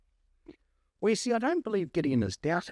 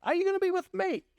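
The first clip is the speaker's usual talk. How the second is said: much higher, fast, urgent plea; anxious Gideon; 13 semitones higher, faster than usual. Acted. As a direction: cry the question up to God. Gideon's question acted out.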